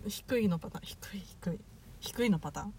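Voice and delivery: low voice. Shown as a demonstration. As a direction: drop the voice low. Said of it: "低い声で喋ってみて".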